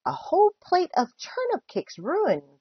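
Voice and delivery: put-on high-pitched child's lament; swooping, dismayed whine; groaning complaint.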